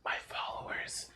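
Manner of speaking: halting, very soft whisper; barely voiced demand; hushed request. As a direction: whisper the wish with pauses.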